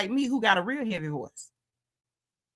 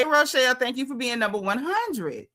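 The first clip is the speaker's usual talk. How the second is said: ordinary talk, nothing marked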